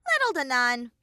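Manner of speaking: high, loud cartoon bear voice; Monokuma voice.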